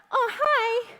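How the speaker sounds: high, sweet, innocent hi; imitating dad's innocent greeting. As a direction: play the dad acting all innocent. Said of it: "He'd be like".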